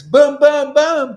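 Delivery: high, loud shouted booms; yelled booms. Real, not acted. Preacher yells boom about their sangha. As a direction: hammer each boom out. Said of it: "Sorry about yelling".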